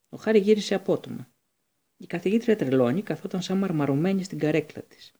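ordinary delivery

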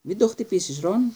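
a mock shriek at Ron; shrieked warning. A narrator's reading. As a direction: shriek it to stop him. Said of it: "τσίριξε η Ερμιόνη".

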